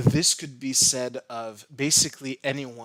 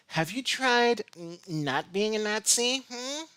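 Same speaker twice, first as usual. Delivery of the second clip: high, sneering quote; mocking voice; 9 semitones higher than usual. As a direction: read it with a mocking lilt. Reading subtitle mockingly.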